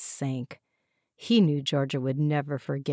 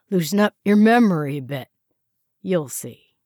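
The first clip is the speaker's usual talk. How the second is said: fast, loud sinister threat; menacing officer; 6 dB louder than usual. A narrator's reading. Interrogator threatening the strapped prisoner, loud and sinister. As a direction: lean in and threaten him.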